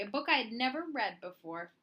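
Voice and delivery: ordinary delivery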